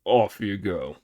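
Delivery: Pig laughing. Acted. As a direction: wave him off cheerfully. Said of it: "laughed Pig".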